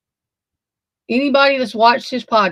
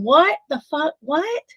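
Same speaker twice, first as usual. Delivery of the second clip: shocked disbelief; 6 semitones higher, 3 dB quieter, slower than usual. Retold or imitated. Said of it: "I can see her shaking, saying".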